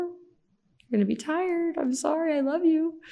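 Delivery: gently telling her daughter she's sorry; mother to child. Retold or imitated. Mother voicing herself to daughter.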